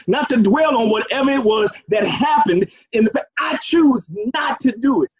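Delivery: preaching at full force. Real, not acted. Preacher rousing the congregation about forgetting the past, full-force preaching. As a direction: build it up and hammer each line home. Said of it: "you preaching that".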